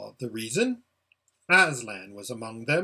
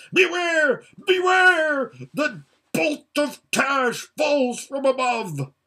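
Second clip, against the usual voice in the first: high, loud, slow prophetic cry; ranting warning; 11 semitones higher, 6 dB louder, slower than usual. Acted. Rabadash warning the kings of Tash's bolt, a high loud cry. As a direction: cry doom down on them from above.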